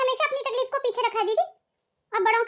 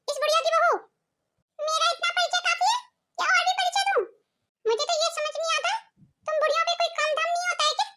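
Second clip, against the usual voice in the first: rude mocking daughter-in-law; 5 semitones higher than usual. Rude daughter-in-law insults guest.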